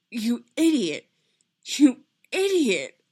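slow, loud, rising in pitch; stunned anger building; mounting reproach. A narrator's reading. Stunned repetition, building in intensity.

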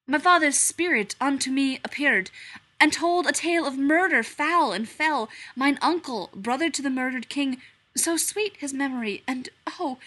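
loud theatrical verse; declaiming Hamlet. Acted. Hamlet declaiming to the stranger, loud and theatrical. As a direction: proclaim it from the stage.

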